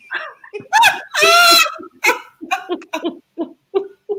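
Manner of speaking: high, loud laugh with a cry in it; laughing through tears. Real, not acted. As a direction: overcome laughing and half crying. Laughs, near tears, at memory.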